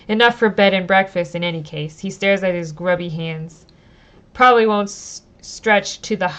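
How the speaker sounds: reading the story text aloud, steady and clear; storyteller read; story narration. Acted. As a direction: narrate the scene for the audience. Reads the game text aloud.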